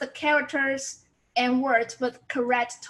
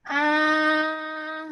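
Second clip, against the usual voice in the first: "ah" held long on one unchanging note; first tone. Shown as a demonstration. Teacher modelling a flat tone, "ah" held steady and level. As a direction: sustain "ah" evenly, like a flat line. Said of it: "no change".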